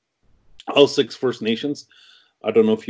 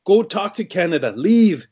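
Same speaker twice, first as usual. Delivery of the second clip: high-pitched mock yell, driving him out; retold yelling; 10 semitones higher than usual. CEO yelling at him in front of shareholders, high hammed-up yell. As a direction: imitate the furious CEO throwing him out. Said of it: "He started yelling".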